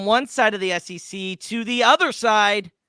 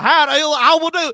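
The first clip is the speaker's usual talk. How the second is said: higher, louder mock Southern accent; 9 semitones higher, 10 dB louder than usual. Mocking a coach's fake Southern accent to co-hosts, hammed-up Southern accent. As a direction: ham up a Southern accent, pitched up and loud. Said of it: "tried to do the Southern accent".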